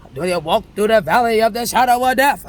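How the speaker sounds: loud, preacher-like psalm line; scripture declaimed with weight; psalm quote. Retold or imitated. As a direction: proclaim it like a sermon. Quoting the psalm.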